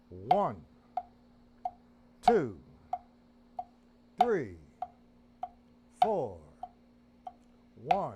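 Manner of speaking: each count barked out on the beat; counted loudly. Shown as a demonstration. Drum teacher counting out loud to students. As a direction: call each count loudly over the drums. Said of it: "Left hand".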